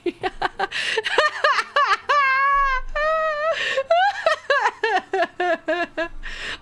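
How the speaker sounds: real high-pitched peals of laughter; extended squealing laugh; long laughing fit. Real, not acted. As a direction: crack up helplessly, very high. Cracks up at own joke.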